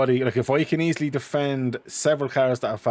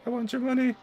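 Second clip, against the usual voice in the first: higher, softer wheedling voice; mock greedy beggar; 11 semitones higher, 4 dB quieter than usual. Teasing co-host, begging for his money in a mock voice. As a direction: beg for money in a silly voice.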